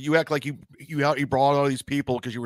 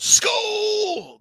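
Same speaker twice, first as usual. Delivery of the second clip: yelled out. Retold or imitated. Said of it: "John's yelling out".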